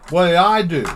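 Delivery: a loud exasperated "What did I do?"; exasperated question. Shown as a demonstration. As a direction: blurt "What did I do?" at full volume. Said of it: "and I say it just like that".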